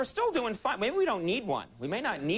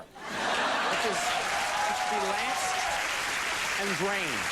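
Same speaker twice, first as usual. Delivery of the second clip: laughing through punchline; 4 semitones higher, much slower than usual. Real, not acted.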